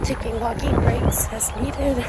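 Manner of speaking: breathless, struggling mid-run; panting between words; while running. Real, not acted. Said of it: "I'm struggling".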